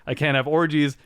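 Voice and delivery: ordinary talk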